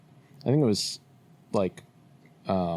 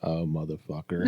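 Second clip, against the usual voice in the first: low, softer mock dismay; retold dismay; 7 semitones lower, 5 dB quieter than usual. Caller hearing they now sound Southern, mock dismay. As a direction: drop it low, like sinking realisation. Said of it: "I'm like".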